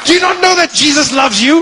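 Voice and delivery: high, loud preaching question; loud high missionary zeal; zealous shout. Acted. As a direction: preach it at him.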